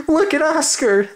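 high, loud mocking dog voice; jeering dogs. Dogs taunting Oscar in his costume, high jeering cry. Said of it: "they cried".

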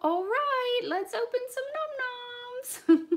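very high, loud cartoon piglet; drawn-out squeaky character voice; terrible Peppa Pig. Announcing the toy opening to viewers, badly imitated high pig voice. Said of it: "My Peppa Pig accent is terrible".

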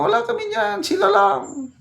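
high mock squeak of officials shrugging off blame. Commentator mocks officials dodging blame in a high squeaky voice. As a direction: parody their denial in a high, silly voice.

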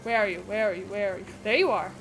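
ordinary delivery

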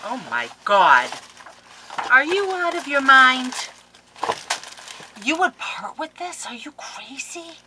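high, loud playful disbelief; mock outrage. Crafter mock-scolds friend for parting with paper. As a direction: scold her for giving this away.

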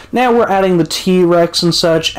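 ordinary talk

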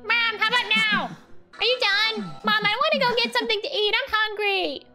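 put-on high, nagging little kid begging his mom; impatient child pestering mom to leave and eat; whiny child. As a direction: whine like a hungry kid who can't wait any longer. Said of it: "I feel like a child".

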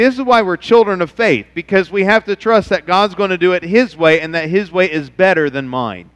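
normal talking voice

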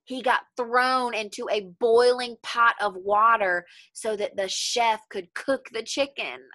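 ordinary delivery, nothing marked

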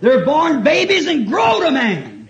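loud, high, drawn-out pulpit cry; slow, ringing preacher's shout; preaching shout. Real, not acted. Preacher driving a point home to his congregation, shouting.

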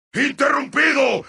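rousing, projected exclamation; emphatic announcer shout. Acted. Announcer declaring a streak interrupted, emphatically. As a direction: cry it out with force. Higher take of announcer call.

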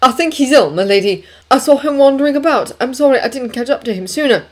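quick-thinking servant. A narrator's reading. Servant covers up to her ladyship, quick excuse. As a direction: offer a hasty, respectful excuse. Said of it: "Thinking quickly".

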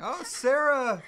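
loud, high, delighted hello; excited greeting. Real, not acted. Host greets a viewer joining the live stream, excited. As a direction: call out her name in happy surprise.

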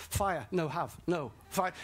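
ordinary delivery

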